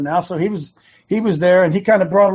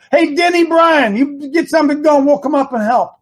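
calling out; 8 semitones higher, 4 dB louder than usual. Acted. Host calling on Denny Bryan to get going.

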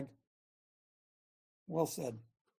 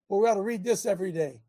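usual speaking voice

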